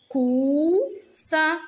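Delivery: "Coussin" split into cou, ssin; syllable by syllable. Shown as a demonstration. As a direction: hold "cou", pause, then "ssin" in "Coussin". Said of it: "Frappe les syllabes". (« Coussin » en deux syllabes bien détachées)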